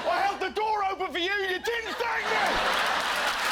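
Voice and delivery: high indignant yell, stretching out the end; mock furious rant. Comedian ranting at a stranger, hammed-up petty fury. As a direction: rage over petty manners like it's a crime. Comic outburst of English anger.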